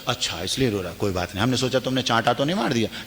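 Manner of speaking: father-in-law reassured, murmuring his relief; relieved elder. Acted. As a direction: brush it off lightly, hushed. Relieved elder, soft fast reply.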